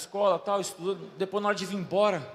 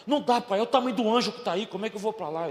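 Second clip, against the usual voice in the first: acted-out son protesting, high and loud; exasperated kid arguing back at his father; raised, urgent voice of a retold objection; child's protest; 4 semitones higher, 6 dB louder, faster than usual.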